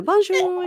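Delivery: high, dainty Bonjour; own French bonjour. Retold or imitated. Showing her own shop French, high Bonjour. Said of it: "I'd, you know".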